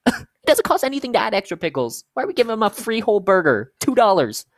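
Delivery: high, loud incredulous venting; exasperated rant. Acted. Cashier venting over free burgers, exasperated. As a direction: rant at full volume, outraged.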